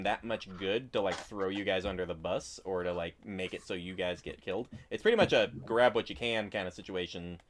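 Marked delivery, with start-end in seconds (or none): none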